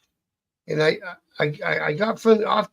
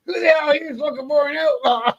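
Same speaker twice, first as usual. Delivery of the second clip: a much higher, louder hammed-up yell; imitated yell; 10 semitones higher, 5 dB louder than usual. Mechanic bellows across the empty pub, high hammed-up yell. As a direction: bellow it across the room in a put-on voice. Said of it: "He yells".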